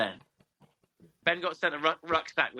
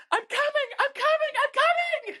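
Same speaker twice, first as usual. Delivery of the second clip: very high squealed repeated cry; imitated panicked rider. Imitating woman at show jumping, falsetto squeal. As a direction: mimic a rider calling out in falsetto. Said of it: "and she was like".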